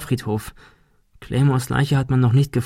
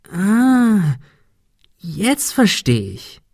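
long high "ah", then slow understanding; dawning realization; 8 semitones higher, much slower than usual. A narrator's reading. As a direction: let the penny drop slowly, pitched up. Puzzled Ben suddenly catches on. (gedehnt, plötzlich begreifend)

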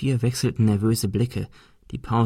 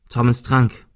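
ordinary delivery, nothing marked

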